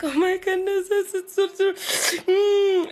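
high, fluttery gasp of fake dread; mock horror. Hearing French now as scary, gasping in mock fright. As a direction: recoil like love words are terrifying. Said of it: "now we're like".

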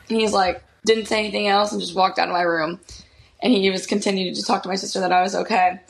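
ordinary delivery

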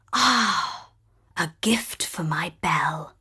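breathy murmur to himself; hushed whisper. Acted. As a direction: whisper it privately. Father thinking aloud, hushed.

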